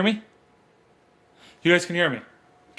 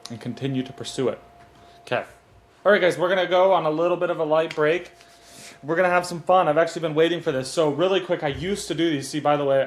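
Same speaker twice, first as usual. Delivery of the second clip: usual speaking voice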